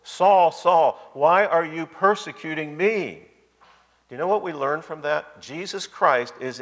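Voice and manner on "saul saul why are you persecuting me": raised, demonstrative divine challenge; commanding voice from heaven; emphatic call. Retold or imitated. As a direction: cry the name out high and loud, then press the question slowly. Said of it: "That's very emphatic there".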